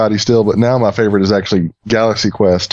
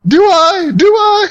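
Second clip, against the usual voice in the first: shrieking, much higher and louder, near tears; mock panic; 4 dB louder, much slower than usual. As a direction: squeal it in comic terror. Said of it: "my favorite part".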